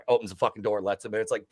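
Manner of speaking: ordinary talk, nothing marked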